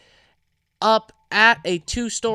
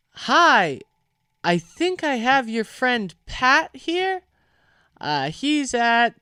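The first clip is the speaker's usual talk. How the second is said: higher, slow, hesitant phone manner; voiced woman caller; 6 semitones higher, slower than usual. Woman calling about a lost friend, hesitant. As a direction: imitate a polite stranger on a call, pitched up.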